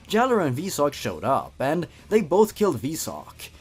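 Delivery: usual speaking voice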